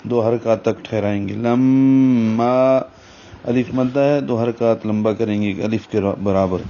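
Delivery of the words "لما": لما with ghunna held two counts through the nose; slow nasal demo; nasal ghunna on lam. Shown as a demonstration. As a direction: hold the nasal sound in لما for two beats. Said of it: "آواز ناک میں لے جائیں گے".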